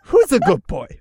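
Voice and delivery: high, loud dog-praising voice; dog talk to people. Pretend. Meeting a new person, talking to him like a good dog. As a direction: greet a stranger like a puppy, pitched high. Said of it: "It's just like".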